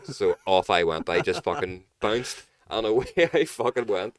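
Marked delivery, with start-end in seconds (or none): none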